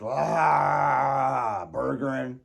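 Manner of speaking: hammed-up frustrated groan; mock frustrated groan. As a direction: groan it like dad losing it. Mimicking dad's frustration.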